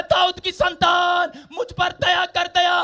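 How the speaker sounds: high, shouted plea for mercy; loud crying out. Acted. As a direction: stretch the call out at full voice. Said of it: "और जोर जोर से चिल्लाने लगा".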